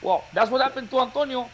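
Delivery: ordinary delivery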